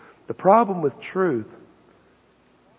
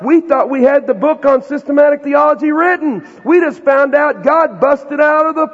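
high, loud and bursting with news; excited elders; 8 semitones higher, 6 dB louder than usual. Acted. Elders announcing to the council, excited high shout. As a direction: elders thrilled that God outgrew their outline.

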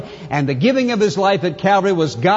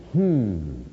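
thoughtful hmm; 9 semitones lower, 6 dB quieter than usual. Pretend. Imagined reader pondering, trailing off.

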